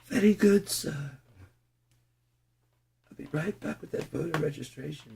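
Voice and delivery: soft high British butler reply; British butler accent. Acted. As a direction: answer like a polite British servant. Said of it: "the British accent".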